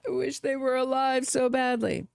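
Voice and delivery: a hint of a hammed-up mourning mother; mock grieving mother. Host voicing what a grieving mother would cry, mock lament. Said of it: "Notice she wasn't crying out".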